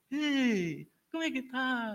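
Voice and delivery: put-on high, sing-song baby talk; fala de bobo com bebê. Shown as a demonstration. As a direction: coo a greeting to a baby, pitched well up. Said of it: "falando de bobo". (voz boba e aguda de falar com bebê)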